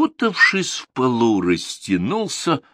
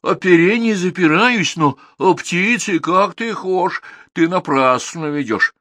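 raised, insistent lad; lightly voiced stubborn Ivan; protesting Ivan; 10 semitones higher, 4 dB louder than usual. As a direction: Ivan arguing about the bird. Ivan argues.

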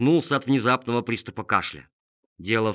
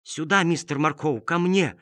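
calling out; 6 semitones higher than usual. Acted. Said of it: "продолжал звать тот же голос".

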